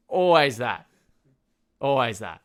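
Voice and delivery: ordinary talk, nothing marked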